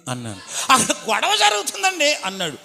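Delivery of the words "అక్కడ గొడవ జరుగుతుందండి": high urgent report. Retold or imitated. Uncle reports fight excitedly.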